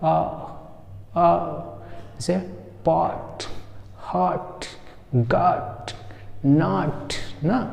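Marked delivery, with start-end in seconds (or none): 0.0-1.4 आ between "aa" and "o"; isolated demonstration of rounded आ; rounded open vowel. Shown as a demonstration. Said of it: "इसको क्या बोलेंगे?". 2.8-7.1 पॉट, हॉट, गॉट, नॉट with rounded open vowel; neither full आ nor ओ in पॉट, हॉट, गॉट, नॉट; vowel between aa and o. Shown as a demonstration. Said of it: "ना आ बोलेंगे ना ओ बोलेंगे".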